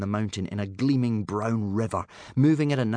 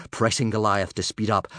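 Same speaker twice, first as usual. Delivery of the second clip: usual speaking voice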